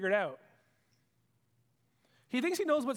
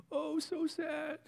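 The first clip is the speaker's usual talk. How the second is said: mock pity; 8 semitones higher, much slower than usual.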